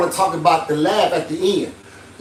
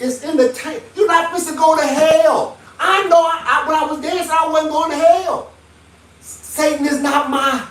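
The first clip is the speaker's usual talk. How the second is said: fired-up preaching; 6 semitones higher than usual. Real, not acted. Heated defense of himself.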